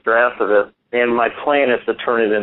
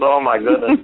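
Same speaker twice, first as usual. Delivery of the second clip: mock amazement; 13 semitones higher than usual. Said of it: "He goes".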